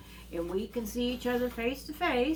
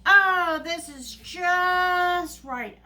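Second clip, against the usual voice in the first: high, loud, blissful sigh of relief; Goldilocks; 7 semitones higher, 7 dB louder, much slower than usual. Acted. Goldilocks settling into the little bed, delighted drawn-out approval. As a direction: stretch it out high, almost singing. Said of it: "and goes".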